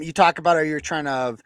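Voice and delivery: usual speaking voice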